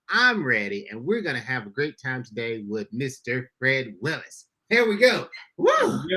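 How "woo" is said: high-pitched "woo"; excited whoop. Real, not acted. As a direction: let out a celebratory whoop. Host whoops after long guest introduction.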